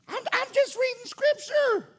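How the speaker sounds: very high, comic squeak; mock-innocent defence. Mock defence after hard verse.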